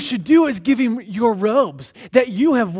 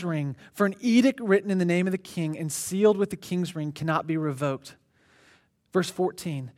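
low, soft, measured Bible reading; scripture reading; 6 semitones lower, 8 dB quieter than usual. Acted. Preacher reads Esther's plea to the congregation, hushed and solemn. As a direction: read the verse solemnly to the room.

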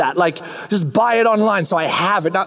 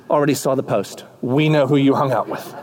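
bored coworker; 8 semitones lower than usual. Acted. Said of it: "They're like".

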